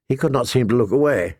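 ordinary delivery, nothing marked

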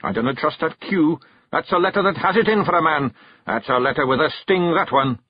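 high, quick Scots grumbling; suspicious Feegle. Acted. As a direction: distrust a letter like an enemy. Rob's voice.

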